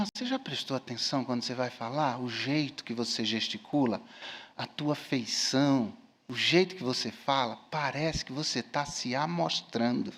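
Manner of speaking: low, soft pastoral admonition; gentle private correction. Retold or imitated. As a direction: take someone aside and tell them quietly. Retells private correction of the student. (conselho em voz baixa, com cuidado)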